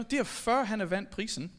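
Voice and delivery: ordinary delivery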